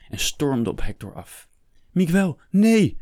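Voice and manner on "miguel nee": high, loud cry of warning; urgent, raised-pitch shout; alarmed call. A narrator's reading. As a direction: shout to stop him. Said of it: "hoorde hij de abt roepen".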